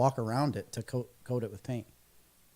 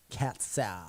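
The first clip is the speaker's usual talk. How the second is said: put-on soft lazy Valley Girl drawl; 3 semitones lower, 7 dB quieter than usual. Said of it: "That kinda sounded like a Valley Girl".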